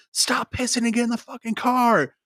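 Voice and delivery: high, loud mock yell from the car; mock friends yelling. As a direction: holler it at him to hurry up. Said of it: "They're in the car yelling at me".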